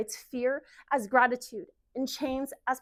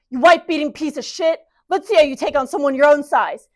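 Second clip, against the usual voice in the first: loud, raised angry challenge; enraged confrontation at full volume; furious shout; 4 semitones higher, 15 dB louder than usual. Acted. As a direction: roar at a bully, squaring up to him. Dale attacks the abusive father.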